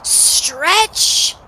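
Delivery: high, loud "Stretch!" rising upward; excited exclamation. A narrator's reading. Narrator opening the runner's warm-up, excited exclamation. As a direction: call it out big and high.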